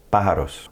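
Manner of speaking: "Pájaros" as PÁ-ha-ros; stress on accented A. Shown as a demonstration. Teacher points to accent mark in Pájaros, stressed PÁ. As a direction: hit the first syllable of "Pájaros". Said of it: "where we're gonna put our stress".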